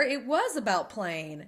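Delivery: ordinary delivery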